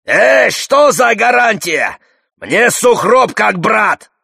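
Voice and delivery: slow, high indignant shouting; Чингачгук. A narrator's reading. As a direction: yell back in protest, stretching the words. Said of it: "Чингачгук кричит". (протяжно и возмущённо кричит)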